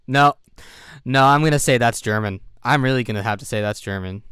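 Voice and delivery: loud and emphatic, committing to German; loud insistence. Real, not acted. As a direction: firmly stake the guess, loudly. Insisting on a GeoGuessr answer.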